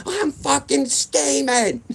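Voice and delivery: high, loud, slow mom yell; hammed-up furious mother blowing up. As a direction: mom yelling at her laughing son, high and loud. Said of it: "I know you must be mad".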